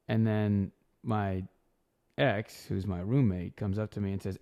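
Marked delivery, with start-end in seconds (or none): none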